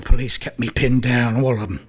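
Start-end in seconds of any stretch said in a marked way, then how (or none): none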